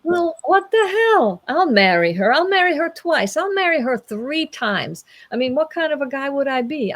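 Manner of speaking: high-pitched eager bravado, easing toward the end; enthusiastic young man. Acted. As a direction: gush like a keen young knight volunteering. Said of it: "being the enthusiastic young man".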